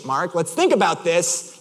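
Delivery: slow, measured appeal; restrained reasoning. Retold or imitated. Driver stepping in to reason with friend, restrained. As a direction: hold the frustration in.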